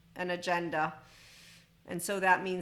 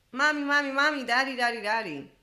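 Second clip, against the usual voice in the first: put-on high and much louder childlike calling of mommy and daddy; a toddler calling out to an unresponsive parent, getting louder; three-year-old crying out; 6 semitones higher, 6 dB louder than usual. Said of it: "when a three-year-old, imagine".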